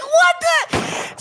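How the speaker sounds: startled exclamation; shocked. Retold or imitated. Catching daughter in a lie, shocked shout.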